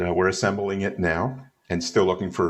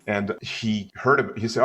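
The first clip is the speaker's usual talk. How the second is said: usual speaking voice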